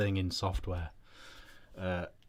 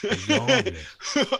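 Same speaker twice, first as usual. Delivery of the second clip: laughing mock line; 14 semitones higher, 10 dB louder, much slower than usual.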